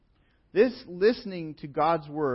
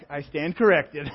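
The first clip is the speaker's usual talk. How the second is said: laughing admission; 3 semitones higher, 7 dB louder than usual. Retold or imitated. Admitting the lady was right.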